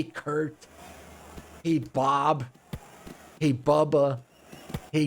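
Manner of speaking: put-on a light American bro accent, drawn out on "soccer"; mock American bro. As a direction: parody American kids calling friends to play. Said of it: "Roasting the U S".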